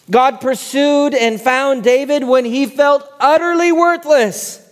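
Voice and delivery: loud, high-pitched sermon delivery; preaching at full force. Real, not acted. Pastor preaching David's story to the congregation, full force. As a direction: belt it out to the whole room.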